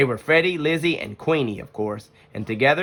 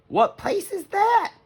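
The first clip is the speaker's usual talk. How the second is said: put-on much higher girlish cub voice; little sister voice; slower than usual. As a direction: sound eager and sweet.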